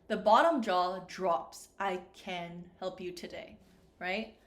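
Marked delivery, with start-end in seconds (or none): 1.8-3.6 "can" with the reduced vowel, like ken. Shown as a demonstration. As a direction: say "can" as ken, low and easy. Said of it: "The vowel sound for can sounds like ken".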